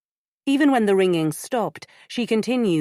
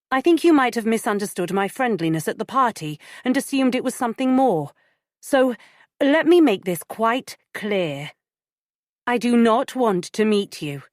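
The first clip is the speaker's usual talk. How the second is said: firm; 6 semitones higher, 4 dB louder than usual. Acted. Firmly rebuffing an unwanted caller.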